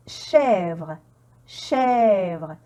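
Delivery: "chèvre" with a long, held open è; prolonged è. Shown as a demonstration. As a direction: stretch the vowel in each "chèvre". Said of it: "il est prolongé".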